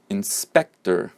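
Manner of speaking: "inspector" slowly as in-SPEC-ter; middle-syllable stress. Shown as a demonstration. Teacher models word five, slow middle stress on "inspector". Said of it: "the middle one is".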